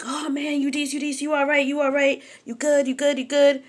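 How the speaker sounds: high-pitched, repetitive mock reassurance; high, chanting imitation of worried onlookers; concerned friends. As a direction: imitate a fussing crowd in a high voice. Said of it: "everybody's like".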